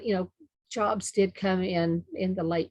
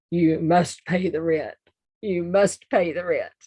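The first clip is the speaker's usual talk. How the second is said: mustache twirling villain; 6 dB louder than usual. Pretend. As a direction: sneer it like a melodrama villain. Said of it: "let's see if I can remember it".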